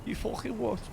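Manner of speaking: speaking through a mask; muffled mask voice. Acted. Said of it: "the muffled one".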